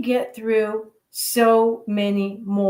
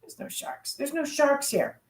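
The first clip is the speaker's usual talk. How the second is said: whispering instructor; 2 semitones higher, 7 dB quieter than usual. Retold or imitated. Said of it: "He's going".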